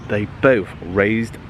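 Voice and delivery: ordinary delivery, nothing marked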